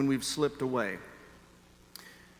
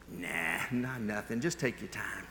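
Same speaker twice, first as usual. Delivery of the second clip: low, soft, deflated reply; sheepish backing down; 7 semitones lower, 8 dB quieter than usual. Retold or imitated. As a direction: back off meekly, much lower and quieter. His sheepish climb-down to the cashier.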